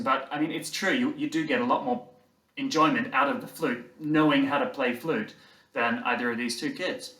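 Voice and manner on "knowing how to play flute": ordinary talk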